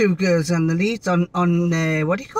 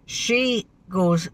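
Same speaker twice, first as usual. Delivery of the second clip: ordinary delivery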